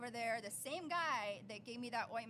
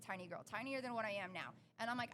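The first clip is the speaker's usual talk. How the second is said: normal talking voice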